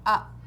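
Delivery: Up said as one loud short beat; one syllable. Shown as a demonstration. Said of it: "as we say the word up".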